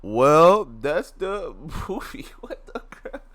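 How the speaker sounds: usual speaking voice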